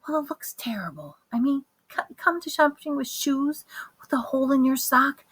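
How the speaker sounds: hushed, scolding whisper; mother hissing at her son in a shop, whispered; urgent whisper. Acted. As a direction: whisper the complaint under your breath. Mother hushing son in store.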